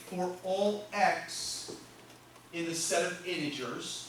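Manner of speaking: slow, evenly spaced words; slow dictation. Shown as a demonstration. Teacher writing the formula for students, slow dictation. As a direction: give them time to write it.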